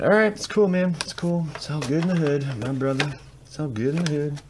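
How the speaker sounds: high-pitched mock reassurance; feigned calm. Card opener consoling himself after a bad pull, feigned calm.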